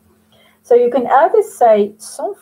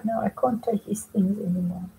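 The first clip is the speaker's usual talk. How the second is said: unvoiced, secretive aside; whisper; 7 dB quieter than usual. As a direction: confide it under your breath.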